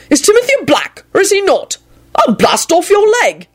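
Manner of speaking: very high, forceful interrogation; threatening. A narrator's reading. Wizard with wand out questioning elf, threatening high voice. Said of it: "he added threateningly".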